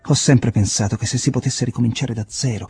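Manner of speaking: loud, high, elated declaration; fervent. A narrator's reading. Jim's fervent parting words.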